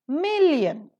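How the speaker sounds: million with MIL high and loud; million, high first syllable, light ending; stress on first syllable. Shown as a demonstration. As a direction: punch the start of million. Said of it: "The first syllable is accented".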